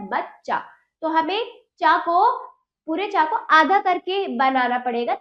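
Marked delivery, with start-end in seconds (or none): 0.1-0.6 बच्चा as bach-chaa, two beats; no vowel between the two च of बच्चा; correct half च. Shown as a demonstration. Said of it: "क्या होता है?".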